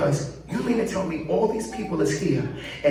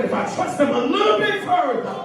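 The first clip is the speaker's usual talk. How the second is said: preaching shout; 8 semitones higher than usual. Real, not acted. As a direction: lift it into a full shout for the crowd. Preacher building to climax.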